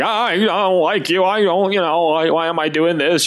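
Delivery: mock-sob it like a sulking kid; whiny crying child. Preacher as sulking child complaining to God, high whine. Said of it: "I'm crying over here like".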